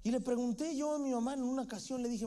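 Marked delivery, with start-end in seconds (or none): none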